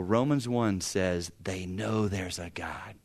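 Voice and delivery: hushed, slow whisper. Real, not acted. Preacher whispers scripture to the congregation. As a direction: let them in on a secret.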